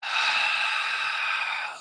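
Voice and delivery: long breathy sigh out through the mouth; demonstration sigh. Shown as a demonstration. Guide showing the listener how to sigh, audible exhale. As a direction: let the breath fall out in a sigh. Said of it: "with each exhale".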